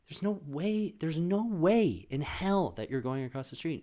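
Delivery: mom refusing. Retold or imitated. Imitating mom refusing the boys.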